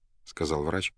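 plain reading voice

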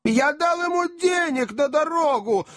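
very high-pitched hysterical cry; frantic yelling. A narrator's reading. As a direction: yelp it like a man gone mad. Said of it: "закричал мистер Тапман".

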